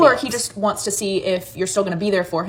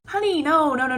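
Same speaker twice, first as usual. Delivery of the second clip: much higher, teasing "honey"; sassy honey; 7 semitones higher than usual. Real, not acted. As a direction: swoop way up on "honey", playfully scolding. Scolding a lovesick friend.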